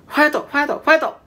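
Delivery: very high-pitched supporter's chant; high mock cheer. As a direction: play a peppy Japanese cheer, high and quick. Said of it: "when cheering someone on".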